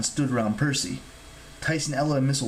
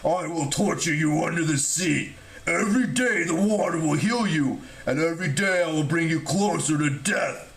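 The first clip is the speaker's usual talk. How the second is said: snarling giant; 4 semitones higher than usual. A narrator's reading. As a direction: snarl the threat, stretching every word. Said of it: "Polyboat snarled".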